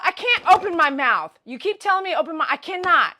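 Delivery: loud, high, exasperated complaint; worked-up shouting back at the dentist; acted-out protest. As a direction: protest loudly, pitch shooting up.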